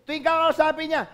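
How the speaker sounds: ordinary talk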